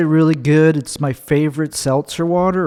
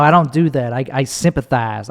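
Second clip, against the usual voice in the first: usual speaking voice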